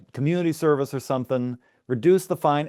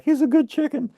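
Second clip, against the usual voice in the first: imitating a crying man, pitched way up; mock sob, much higher voice; high whiny plea. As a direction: plead for the chicken in a high wail. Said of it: "He's like".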